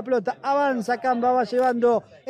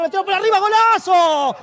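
huge held goal yell, higher and louder; goal scream; 4 semitones higher, 7 dB louder than usual. Real, not acted.

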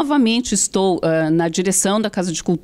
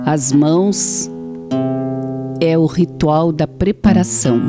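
poem recitation; 3 semitones lower than usual. Acted. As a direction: recite it solemnly, line by line. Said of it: "eu quero que tu diga outro poema pra nós".

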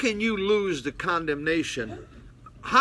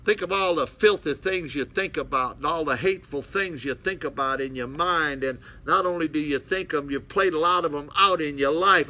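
usual speaking voice

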